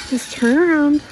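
loud call to the cow. Real, not acted. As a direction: call it out firmly. Pleading with cow.

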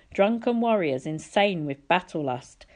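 plain reading voice

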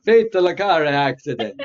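slow, hammed-up Italian accent; silly sing-song Italian accent. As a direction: stretch it out in mock Italian. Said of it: "Oh, now you're Italian".

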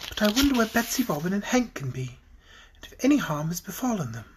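soft, light, wondering voice; airy character voice. A narrator's reading. Fairy wondering about missing friends, soft and airy. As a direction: wonder softly, with a light touch. Polychrome's voice in story.

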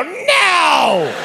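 high, drawn-out gleeful no; hammed-up spiteful denial; mocking refusal. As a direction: refuse with relish, stretched way up high. Staff refuse with relish.

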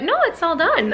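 lightly voiced cashier, pitched high; imitated cashier. Acts out the cashier's reply.

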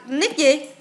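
ordinary delivery

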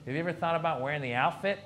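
lightly voiced older club owner; Mitzi. Club owner pitching an outfit to comic, lightly impersonated. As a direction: suggest it in a hint of an old woman's voice. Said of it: "Mitzi talked like this".

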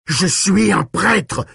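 high, drawn-out indignant yell. A narrator's reading. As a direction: bellow it slowly in protest. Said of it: "hurla Callahan".

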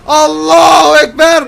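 loud, high shouts building up; alarmed yelling. Pretend. Driver shouts to his boss before a crash, alarmed yelling. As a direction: yell as disaster rushes at you.

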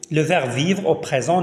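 usual speaking voice

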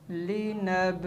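usual speaking voice

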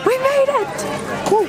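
high joyful exclaim; excited. Real, not acted. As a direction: burst out with relief and joy. Arriving at the tree lighting.

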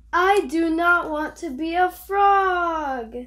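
firm refusal. Acted. Gerald protesting to Piggy, slow and loud. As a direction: insist slowly that you refuse.

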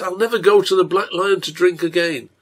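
impassioned vow to quit; near vow. A narrator's reading. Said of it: "He almost says".

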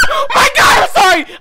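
high-pitched panicked scream; terrified shout. Real, not acted. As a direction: shriek an apology in real fright. Screamed apology after a scare.

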